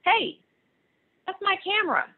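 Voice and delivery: put-on high, loud protesting child; indignant boy. Martin tells Ruthie the camera is his, high and indignant. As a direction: claim it back, offended. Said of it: "Martin said".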